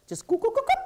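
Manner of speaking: high bubbling goop sounds; bubbling noise. Retold or imitated. Kid describing anger boiling inside, bubbling noises. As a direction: mimic boiling water bubbling up.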